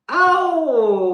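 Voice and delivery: high, loud burst of delight; a big gasp of joy; excited oh. Real, not acted. A reader thrilled by the next card, excited exclamation.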